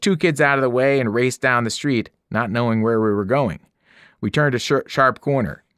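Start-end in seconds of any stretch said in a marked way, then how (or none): none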